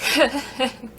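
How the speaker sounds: short soft giggle, imitating someone; brief put-on snicker; imitated laugh. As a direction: mimic a quick nervous little chuckle. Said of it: "Kevin laughs".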